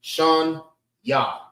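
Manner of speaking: Shun... Ya, split by a pause; teacher breaking the word into Shun, Ya; two syllables. Shown as a demonstration. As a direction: sound out Shun and Ya separately. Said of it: "And two".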